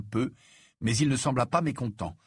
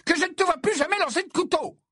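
very high-pitched maternal outburst; furious scolding. A narrator's reading. Mother forbids Ron to throw knives, furious. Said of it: "avec fureur".

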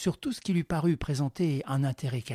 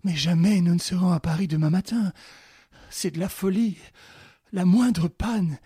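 lamenting; 5 semitones higher, 7 dB louder than usual. Acted. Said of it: "Clarisse qui se lamentait".

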